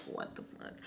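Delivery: barely voiced, under the breath; whispered disbelief. Reacting to the front door leaving, whispered disbelief. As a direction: whisper it to yourself.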